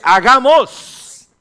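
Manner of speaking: very slow, high and loud; God speaking. Retold or imitated. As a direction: stretch out Hagamos like a proclamation. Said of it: "Dios dice". (Dios dice Hagamos, lento, agudo y fuerte)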